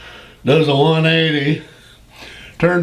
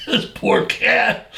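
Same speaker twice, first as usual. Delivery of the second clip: laughing; 8 semitones higher, 4 dB quieter, slower than usual. Real, not acted. Laughing at the memory.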